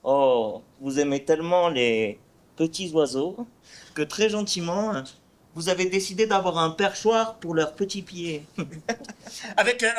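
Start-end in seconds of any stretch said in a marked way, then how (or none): none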